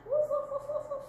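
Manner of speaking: high excited woof woof; puppy barks. Pretend. Teacher playing a puppy dog in yoga. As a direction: bark like a happy puppy. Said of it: "our downward dog".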